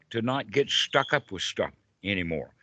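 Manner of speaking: usual speaking voice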